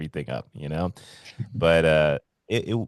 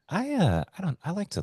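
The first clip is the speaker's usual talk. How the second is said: high hesitant confession; 13 semitones higher, 4 dB quieter than usual. Retold or imitated. Voicing John confessing.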